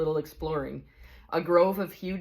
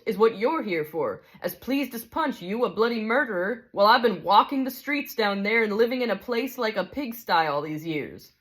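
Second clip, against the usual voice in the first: higher, aggrieved working-man's complaint; indignant big ghost; 5 semitones higher than usual. Acted. Big ghost accuses the bright spirit over poor Jack, indignant. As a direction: press the grievance like a wronged bloke.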